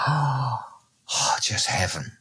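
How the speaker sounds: hushed, reverent whisper; breathy whisper. Retold or imitated. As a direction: whisper it like heaven.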